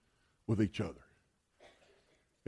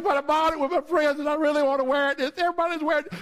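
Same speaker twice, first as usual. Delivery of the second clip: higher, whiny-teen imitation; pleading daughter; 7 semitones higher, faster than usual. Daughter arguing for her new dress, acted pleading. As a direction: plead back at mom in a put-on girl's voice.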